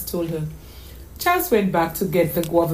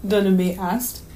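plain reading voice

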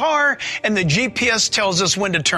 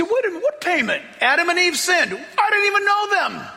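mock objector; 9 semitones higher than usual. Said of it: "You say".

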